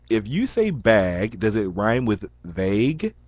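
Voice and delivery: usual speaking voice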